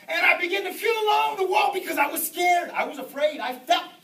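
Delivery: loud, high, emphatic preacher; full-force preaching. Real, not acted. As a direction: drive each phrase home with mounting intensity. Preaches the demon story forcefully.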